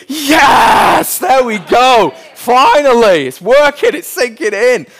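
high, loud celebratory shout; triumphant cheering. Acted. Dad hears son's unprompted thanks, triumphant loud cheer. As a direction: cheer it out in exaggerated parental relief.

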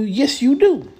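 high, loud mock insistence; high-pitched, emphatic contradiction. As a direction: insist loudly, as a comic comeback. Acting out dog's insistent verdict.

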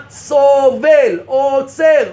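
each word drawn out slow and loud; slow, weighty emphasis on סובל, עוצר; hammered words. Acted. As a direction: pound סובל and עוצר out one at a time. Preacher hammers key words.

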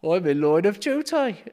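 high, loud Brummie boast; proud Brummie voice; Birmingham accent. Acted. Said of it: "doing a Birmingham accent like this".

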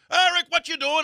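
loud, high South African greeting; South African accent. Retold or imitated. Rocker spotting his old host backstage, imitated South African accent. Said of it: "thick, like, you know, South African accent going".

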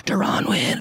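a hint of a sly inner voice naming the pick; lightly voiced nagging inner voice, not whispered; little voice. The inner voice insists on its betting pick, lightly voiced. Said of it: "little voice in my head".

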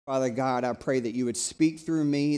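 ordinary talk, nothing marked

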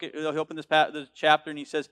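ordinary delivery

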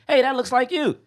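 put-on high little-girl voice; high child voice of the little sister. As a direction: pipe it up like a small girl. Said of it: "this kid been able to say".

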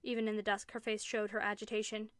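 ordinary delivery

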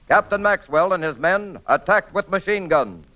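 ordinary talk, nothing marked

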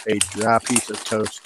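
usual speaking voice

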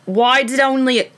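attempted accent slipping into British; accidental British accent. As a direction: try their accent, land in British. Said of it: "not the British".

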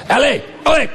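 high shouted French shooing; French accent shout. Retold or imitated. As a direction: shoo them off in French, shouting. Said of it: "the French don't like Americans".

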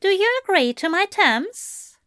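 high, lilting question; angel's high, coy question; knowing angel. A narrator's reading. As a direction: ask pitched well up, as if you didn't know. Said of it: "as if he didn't know".

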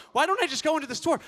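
high, fast, loud disbelieving echo of "don't"; incredulous repeat. Retold or imitated. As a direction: echo her idea in disbelief. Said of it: "She's like".

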